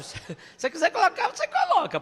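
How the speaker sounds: mocking laugh. Teacher mocking a careless choice.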